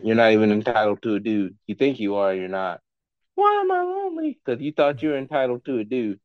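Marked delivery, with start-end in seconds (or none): 3.4-4.4 mock spoiled princess voice; mocking whiny voice. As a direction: sneer it in a shrill put-on girly voice. Mocking entitled women.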